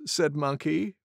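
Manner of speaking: ordinary delivery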